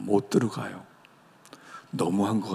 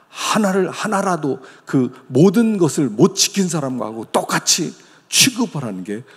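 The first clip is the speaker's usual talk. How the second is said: preaching climax; 5 semitones higher, 6 dB louder than usual. Real, not acted.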